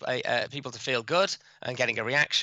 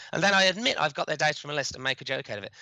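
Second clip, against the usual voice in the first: ordinary talk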